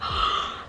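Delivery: imitated kids' gasp of wonder; whispery, voiceless gasp, mock astonished; amazed gasp. Kids hearing Mickey knows their story, amazed gasp.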